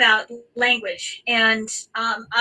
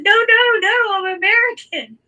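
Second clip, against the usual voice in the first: flustered protest; 11 semitones higher, 6 dB louder than usual. Retold or imitated. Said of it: "I dropped my German altogether".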